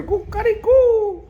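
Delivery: a high, slow, stretched cock-a-doodle-doo; rooster crow. Retold or imitated. Rabbi imitates the boasting rooster crowing to the hen. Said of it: "The rooster, says to the hen".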